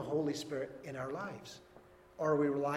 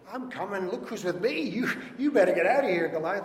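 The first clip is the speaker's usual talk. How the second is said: put-on raised, defiant voice of young challenger; bold challenger; 3 semitones higher, 5 dB louder than usual. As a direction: call out a cocky challenge, pitched up. Acts David charging at Goliath.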